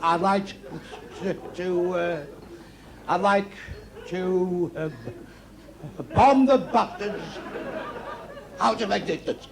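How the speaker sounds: halting stammer with long gaps, repeating "I'd like to"; bad stutter. Drunk stutterer telling his mate his revenge plan, stammering. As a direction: stall on "to", restart, then drag out "bomb the bastards" slowly. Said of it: "One stuttered very badly".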